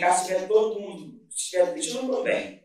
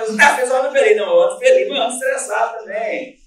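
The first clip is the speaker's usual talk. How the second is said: louder and higher, almost shouting; loud retold exchange; 5 semitones higher, 10 dB louder than usual. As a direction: half-shout the back-and-forth. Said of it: "eu tô gritando pra relaxar". (meio gritando, mais agudo)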